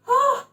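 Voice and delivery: high, tearful cry of joy; real high-pitched emotional outburst; overcome "ah". Real, not acted. Reader overwhelmed with joy for viewers, high teary cry.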